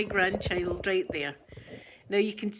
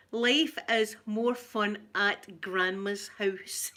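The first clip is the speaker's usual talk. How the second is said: slow, read out through a real laugh; slower than usual. Real, not acted. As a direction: giggle your way through the words. Reads plaque on gift, laughing.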